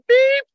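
very high, loud electronic beep; piercing high "beep"; imitated timer beep. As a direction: mimic a shot timer going off.

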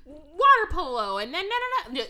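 fast, upbeat activities director; peppy host voice. Retold or imitated. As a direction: hype up the crowd.

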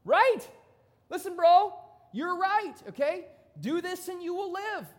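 Jesus loudly congratulating the lawyer, pumped up; hyped-up praise. Retold or imitated. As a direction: cheer him on like a hyped buddy. This is what Jesus goes.

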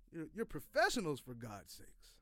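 ordinary delivery

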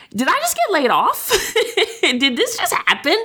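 high, incredulous half-laugh asking herself; stunned, laughing in disbelief at the news; incredulous self-question. Retold or imitated. Catching herself mid-day, asking herself in laughing disbelief. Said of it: "stop and think to myself".

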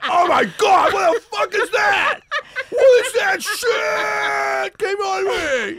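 high loud laughing panic; freaked-out yell. Pretend. Big deleted scene, kid shouting in laughing mock horror. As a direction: shout it slowly through laughter, horrified. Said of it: "and you'd be like".

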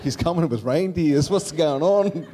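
high, loud and laughing. Retold or imitated. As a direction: laugh through the kid's confusion. Laughing retelling kid confusion.

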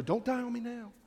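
begging the dying laptop; half-joking plea to a computer; pleading with computer. Retold or imitated. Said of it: "I start to speak to the computer".

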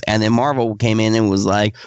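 ordinary talk

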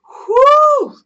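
a high, loud exhale of relief after the rant; loud whew. Real, not acted. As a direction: blow it out, spent and sweaty. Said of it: "I think I sweat a little bit".

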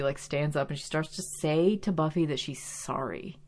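ordinary talk, nothing marked

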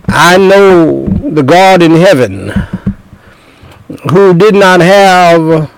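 fervent, high-pitched declaration; impassioned preaching. Acted. Preacher answering noble Festus as Paul did, impassioned preaching. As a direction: declare it boldly, stretching out the key phrases.

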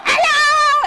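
high, loud impatient shout at the window; yelling hello. Retold or imitated. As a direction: yell it through the glass, fed up with waiting. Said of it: "I'm actually banging on the window".

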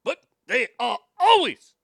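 bouncy, high, loud mock Japanese delivery; mock Japanese inflection. Host shows how they bounce syllables, mock Japanese inflection. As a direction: imitate Japanese speech with punched, bouncing syllables. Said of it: "they bounce their syllables a lot".

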